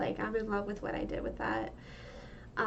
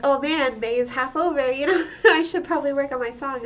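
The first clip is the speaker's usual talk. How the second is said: higher, louder, half-laughing realization; laughing self-scold; 4 semitones higher, 8 dB louder than usual. Retold or imitated. Recalling her own panic about the song, laughing. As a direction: chuckle at yourself as it dawns on you. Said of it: "I was like".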